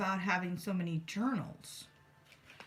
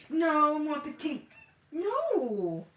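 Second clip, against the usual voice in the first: high, louder and slow, telling off a pet; gently firm no to a playful cat; scolding the cat; 7 semitones higher, 4 dB louder, much slower than usual. Real, not acted.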